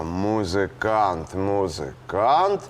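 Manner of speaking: drawn-out, slow syllables; pondering a rhyme. Acted. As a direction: stretch the word while searching for a rhyme. Composing, searching for a rhyme.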